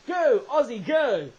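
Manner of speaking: spirited sports-fan shout; loud cheer. Pretend. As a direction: cheer on the team. Cheering on Aussie.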